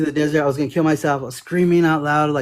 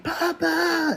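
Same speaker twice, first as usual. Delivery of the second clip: imitating a child's cry; 14 semitones higher, 3 dB quieter than usual. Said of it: "the screams from these kids".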